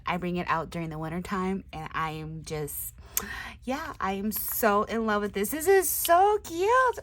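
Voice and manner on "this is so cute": high, loud cooing; high gush. Real, not acted. Crafter loving a sock monkey tag, high gush. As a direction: gush over it.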